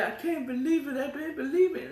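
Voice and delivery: high, giddy disbelief; can't believe her luck; excited. Retold or imitated. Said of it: "she's just so excited".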